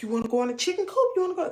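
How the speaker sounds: quick, rising pet-talk invitation; coaxing the dog. Retold or imitated. Owner offering dog the chicken coop, quick eager coaxing. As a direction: speed up and lift the voice to entice.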